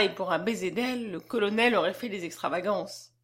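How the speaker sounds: ordinary delivery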